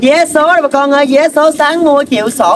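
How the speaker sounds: high, stretched hawking call; vendor's street patter. Acted. Lottery ticket seller advertising today's draw to the street, hawking.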